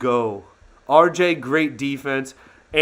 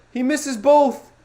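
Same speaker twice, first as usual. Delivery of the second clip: disbelieving cry; 11 semitones higher, 6 dB louder, slower than usual. Real, not acted. Repeating the miss, disbelief.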